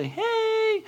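long, high-pitched sing-song hey; playful imitation of a chirpy greeter; mock friendly greeting. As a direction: draw it out high and cutesy.

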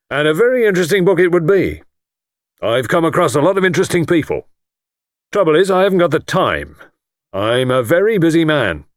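bumptious tycoon talking himself up; pompous lord. Acted. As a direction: puff up and brag quickly.

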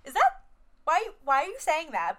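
high, loud disbelieving squeal; mock outrage; incredulous. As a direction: react like you can't believe he said that. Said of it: "I was like".

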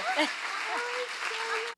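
high, loose laugh. Real, not acted. Preacher joking about sweaty feet, laughing high. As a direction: laugh it off after a confession.

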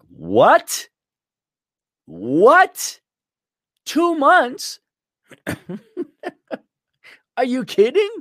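can't believe the wait; incredulous. Retold or imitated. Himself to the repair shop man, in loud rising disbelief. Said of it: "I'm like".

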